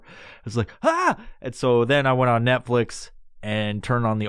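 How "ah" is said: short high scream; sudden comic cry of fright; freaked-out yelp. Retold or imitated. Stoner watching the comedian's mouth, freaked out. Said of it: "It was freaking me the fuck out".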